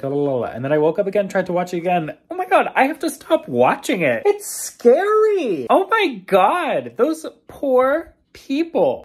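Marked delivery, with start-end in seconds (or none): 2.3-9.0 high, slow, loud horrified wail. Real, not acted. Watching the volcano documentary, horrified slow wail. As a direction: stretch out the horror.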